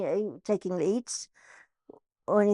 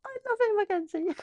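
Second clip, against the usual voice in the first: gushing; 12 semitones higher than usual. Real, not acted. Gushing about old colleague.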